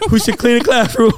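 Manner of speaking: mock panicked kid offering punishments; scared kid pleading. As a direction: beg like a terrified schoolboy, laughing through it. His scared child self pleading.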